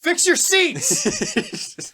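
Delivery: very slow, high-pitched shout; scary yell. Real, not acted. Fan ordering team owners to renovate the arena, stretched-out yell. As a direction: holler it as a drawn-out demand. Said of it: "[name], you're scaring me".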